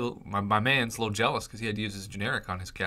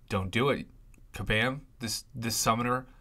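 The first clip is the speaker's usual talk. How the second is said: normal talking voice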